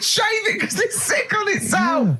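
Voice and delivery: high shrieking laughter through the words; squealing with laughter; laughing shriek. Real, not acted. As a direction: shriek it out while cracking up. Hysterical laughing reaction.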